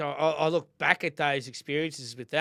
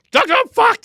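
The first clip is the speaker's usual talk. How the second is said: loud, high blurt; retold desperate outburst; 14 dB louder than usual. Patient confessing to the doctor, loud hammed-up outburst. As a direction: blurt it out at full volume, hammed up. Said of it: "and then went, uh".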